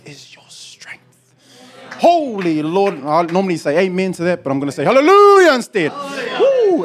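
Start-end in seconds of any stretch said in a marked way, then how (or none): 0.0-1.0 slow, breathy whisper; whispered scripture. Acted. Scripture read hushed. 4.8-6.8 leaps high on hallelujah; joyful hallelujah. Real, not acted. Exuberant shout of praise.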